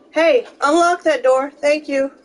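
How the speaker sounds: yelling across the room. Real, not acted. Calling to someone behind door.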